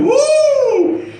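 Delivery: high loud whooping woo; mock crowd woo. Courtroom reacting to the daughter, loud high whoop.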